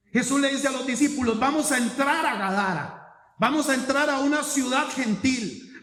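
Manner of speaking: loud, driving sermon delivery; preaching. Acted. Pastor retelling Jesus entering Gadara, fervent preaching. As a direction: drive the story home from the pulpit.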